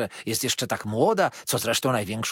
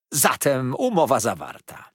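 1 triumphant; 3 semitones higher, 6 dB louder than usual. A narrator's reading. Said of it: "zawołała triumfująco stara".

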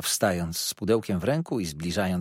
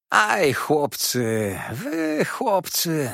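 slow, rising mock scolding; scolding dwarf lady; 7 semitones higher, much slower than usual. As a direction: drag it out teasingly. Karlica gani chłopców. (powoli, żartobliwie karcąco)

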